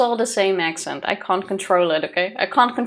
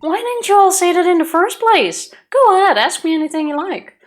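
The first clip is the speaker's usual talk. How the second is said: put-on southern accent; 6 semitones higher, 5 dB louder than usual. Voicing southern NPC in game.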